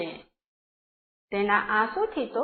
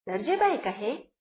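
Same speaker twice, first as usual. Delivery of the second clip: ordinary delivery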